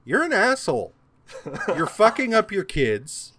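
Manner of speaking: high-pitched, blunt mock insult; Mimir. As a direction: hurl gleeful abuse at the Allfather. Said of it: "being an asshole to him".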